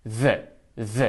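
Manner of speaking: "ذ" buzzed with the tongue between the teeth; voiced th. Shown as a demonstration. Teacher showing the voiced th "ذ" twice. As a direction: buzz "ذ" from the throat. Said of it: "تی اچ با صدا".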